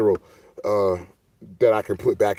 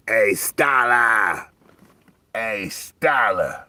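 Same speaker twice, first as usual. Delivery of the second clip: long, stretched-out call from below; imitated yell; 3 semitones higher, much slower than usual. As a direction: holler the name slowly, like the guy outside. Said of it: "he was trying to yell".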